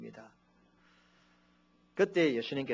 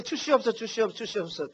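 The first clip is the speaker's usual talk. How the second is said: repeated mock begging; 6 semitones higher than usual. Pastor parodying endless begging prayers to the congregation. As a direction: rattle off 주시옵소서 like a pleading habit. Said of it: "더 이상 안 하겠습니다만은".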